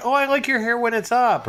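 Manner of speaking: high-pitched mimicked admirer; gushing compliment. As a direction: imitate someone fawning over a hairdo. Imitating people complimenting his hair.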